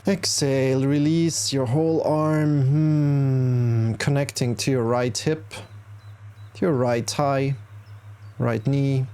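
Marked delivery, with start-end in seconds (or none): 2.6-3.9 long, steady hum on the out-breath; exhaling hum. Shown as a demonstration. Yoga teacher showing the exhale for six, exhaling hum. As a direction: let the exhale out as a sustained "hmm".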